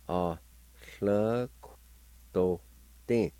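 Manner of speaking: A, hlö kto, tin sounded out slowly with a lateral hl; teacher demo of A hlö kto tin; Cherokee syllables. Shown as a demonstration. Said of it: "like this".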